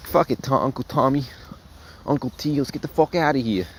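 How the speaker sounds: mock Chicago accent. D-Mac telling Uncle Tommy they should leave, in a rough Chicago accent. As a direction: imitate a Chicago gangster, half-hearted Chicago accent. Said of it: "Not really my best Chicago accent".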